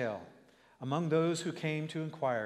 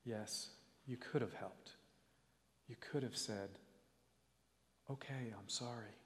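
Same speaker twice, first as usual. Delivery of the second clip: soft, low and hurt; 9 dB quieter than usual. Acted. Boy to his busy father, soft and low. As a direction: say it quietly as you turn away. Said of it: "he said in a low voice".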